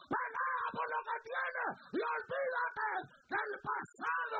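high, loud, slow exhortation; preaching shout. Real, not acted. Preacher rallying the church to fight, full shout. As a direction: shout it like a revival call.

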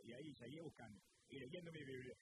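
normal talking voice

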